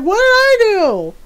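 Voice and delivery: loud, high mock indignation; startled dog protest. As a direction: wail it like a wronged pet. Startled dog protests after being swatted.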